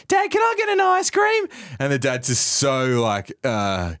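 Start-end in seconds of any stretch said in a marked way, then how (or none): 0.1-1.5 put-on very high little kid pleading; child's voice. Kid on dad's shoulders asking dad for an ice cream, very high child voice. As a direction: beg like a small child, pitched right up. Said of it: "the kid's going".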